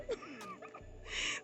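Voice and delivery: high squealing burst of laughter; squealing giggle. Real, not acted. Laughing at her own disbelief.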